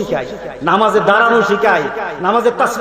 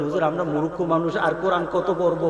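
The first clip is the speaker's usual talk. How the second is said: much softer, lower sheepish plea; meek excuse; 6 semitones lower, 9 dB quieter than usual. Acted. Ignorant man excuses himself to the cleric, meek and soft. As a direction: mumble it like an embarrassed layman.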